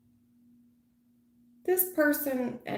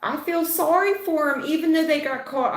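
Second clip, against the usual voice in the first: mocking the viewer; 8 semitones higher, 4 dB louder than usual. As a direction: imitate her guilty sympathy a touch higher.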